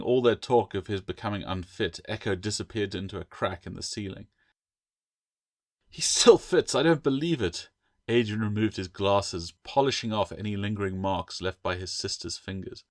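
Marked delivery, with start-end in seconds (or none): 5.9-7.7 high, louder disbelief; stunned at the fat lizard; amazed. A narrator's reading. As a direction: gasp it out in astonishment. Gecko squeezes through ceiling crack.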